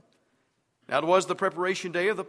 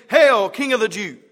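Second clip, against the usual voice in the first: mocking; 2 semitones higher, 10 dB louder than usual. A narrator's reading. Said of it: "mocked him, saying".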